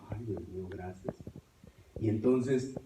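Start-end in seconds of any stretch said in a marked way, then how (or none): 0.1-1.1 gracias susurradas, muy bajito; susurro de alivio. Retold or imitated. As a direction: susurra como rezando aliviado. Alivio íntimo tras la oración. (agradecimiento a Dios en susurro)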